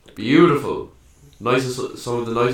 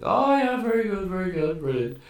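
high, breezy, overeager "oh yeah, very good"; fake-polite agreeing; 11 semitones higher than usual. Drunk narrator to the barmaid, high fake-polite agreement. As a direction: bluff along as if following every word. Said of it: "And I was like".

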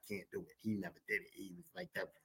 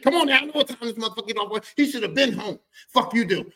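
fast, raised, irritated complaint; acted fed-up father; impatient dad; 8 semitones higher, 5 dB louder than usual. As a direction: grumble loudly at being kept waiting. Impatient dad waiting for uncle.

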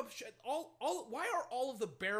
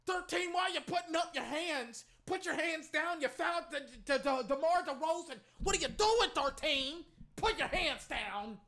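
high, exasperated yelling; angry scolding; 8 semitones higher, 4 dB louder than usual. Real, not acted. Fan scolds player thirteen for fouling, angry and high. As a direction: berate the player from afar.

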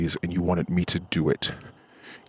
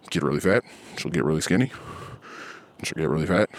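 ordinary talk, nothing marked

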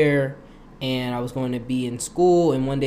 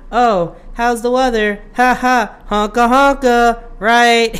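mocking small talk; 9 semitones higher, 8 dB louder, slower than usual.

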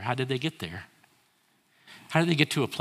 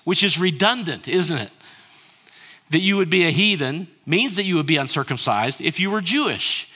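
normal talking voice

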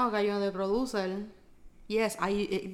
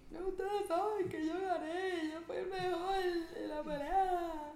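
slow, high whimpering; hammed-up sobbing loser; mock crying boxer; 7 semitones higher, 8 dB quieter than usual. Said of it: "Mi tipo ahí". (imitando llanto de boxeador)

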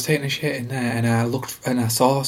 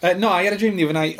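jollier; 7 semitones higher, much faster than usual. Real, not acted. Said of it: "talk jollier".

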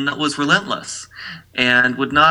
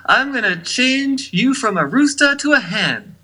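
much higher put-on country star voice; 10 semitones higher than usual. Podcaster quoting a singer threatening a pushy man, high put-on voice. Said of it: "That didn't sound like [name] at all".

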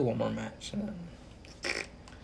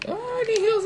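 much higher squeaky voice, mocking a healer-begging ally; mock whiny teammate; 4 dB louder than usual. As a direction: whine for heals in a put-on high voice.

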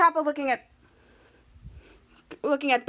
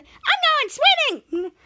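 high-pitched gleeful announcement; excited cry; 12 semitones higher than usual. Retold or imitated. Tiny character diving into chocolate milk, acted excitement.

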